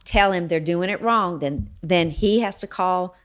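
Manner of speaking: normal talking voice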